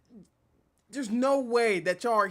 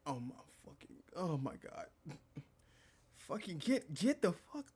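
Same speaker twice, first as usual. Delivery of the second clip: muffled exasperation; 4 semitones lower, 13 dB quieter than usual. Real, not acted. Exasperated at the flattery.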